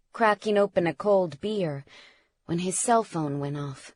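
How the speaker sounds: usual speaking voice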